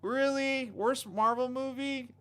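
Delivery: high-pitched plaintive questioning; hammed-up crushed disappointment; dismayed disbelief. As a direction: whine it, unable to believe it. Reacting to worst Marvel movie verdict.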